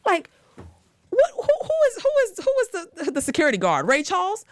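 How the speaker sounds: can't believe it; high incredulous who. Real, not acted. Incredulous questioning.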